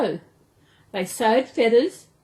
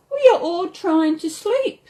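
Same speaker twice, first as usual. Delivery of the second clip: higher and louder plea; calling out; 9 semitones higher, 5 dB louder than usual. A narrator's reading. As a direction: call up, exasperated. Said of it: "Snugglepot called out".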